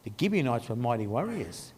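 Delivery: low, hushed dawning alarm; alarmed inner thought. Acted. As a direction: think it aloud softly, as a worried onlooker. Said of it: "starting to freak out, thinking".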